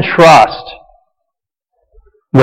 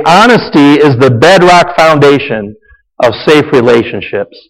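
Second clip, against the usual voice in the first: ordinary talk, nothing marked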